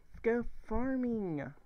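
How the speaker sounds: high, drawn-out playful call; Fenneman side. Pretend. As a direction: sing out slowly like an eager farming fan. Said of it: "my inner Fenneman side's coming out".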